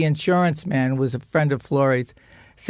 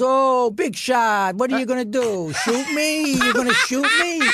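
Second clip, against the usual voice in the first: mocking taunt; 7 semitones higher than usual. Mother taunts the stepfather, laughing and mocking. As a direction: goad him as if daring him to do it. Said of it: "And my mother goes".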